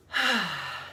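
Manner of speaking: short, falling voiced sigh; audible sigh. Shown as a demonstration. Settling the group in their chairs, audible sigh. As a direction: let out a settling "ahh" sigh. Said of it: "like I'm doing, feel free to make noise".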